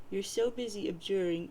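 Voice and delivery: usual speaking voice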